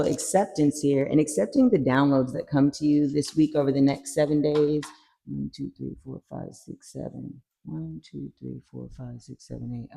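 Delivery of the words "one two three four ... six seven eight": counting under breath. Real, not acted. Counting cards under her breath.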